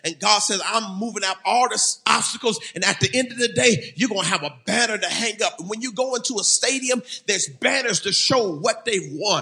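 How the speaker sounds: high, driving sermon delivery; fervent preaching. Real, not acted. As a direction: preach the promise with rising fervor. Preaches the banner promise.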